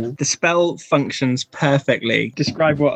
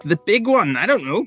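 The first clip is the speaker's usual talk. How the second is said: put-on Scottish kid; 4 semitones higher than usual. GM voices the kid.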